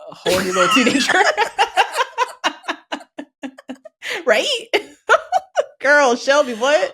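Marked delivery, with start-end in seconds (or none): 1.2-4.2 high, long cackling laugh; big laugh. Real, not acted. Host laughs at horny teenager remark, cracking up. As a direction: burst into sustained high laughter.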